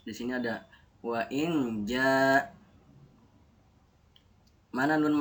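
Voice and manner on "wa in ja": "wa in ja" chanted slowly, syllables drawn out; slow recitation. Shown as a demonstration. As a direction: recite "wa in ja" with long held sounds. Teacher recites the example slowly.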